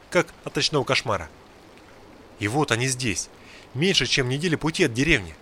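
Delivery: usual speaking voice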